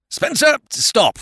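high, slow loud call; desperate plea. Acted. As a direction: stop him at the door. Uncle desperately halting nephew.

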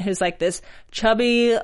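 ordinary delivery

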